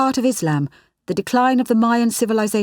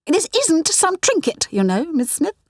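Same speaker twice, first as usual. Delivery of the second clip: higher, slow, briefly flaring snap; snapped; 10 semitones higher than usual. A narrator's reading. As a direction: let the temper flare for a moment. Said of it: "snapped Doctor Minot, her temper flaring briefly".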